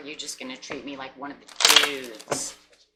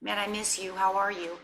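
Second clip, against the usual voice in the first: a touch higher, fond and sorry; mock loving partner; 4 semitones higher than usual. Wished-for partner apologizing warmly to you. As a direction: play the partner who missed you. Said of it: "I wanted to hear".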